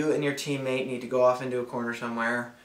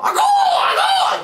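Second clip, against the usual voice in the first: very high, loud, slow chanting call; aku signal call; 14 dB louder, slower than usual. Shown as a demonstration. Player performing his secret signal, slow shrill aku call. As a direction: howl it out like a jungle bird. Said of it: "my signal".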